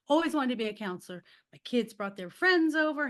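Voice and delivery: ordinary talk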